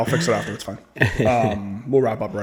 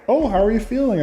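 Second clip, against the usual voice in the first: high-pitched doting mom imitation; mom's voice; 9 semitones higher than usual. Mom asking after surgery, imitated in a high voice. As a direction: imitate a mother checking on her child. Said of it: "my mom's all like".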